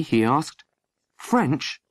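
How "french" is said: usual speaking voice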